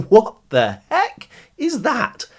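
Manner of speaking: ordinary talk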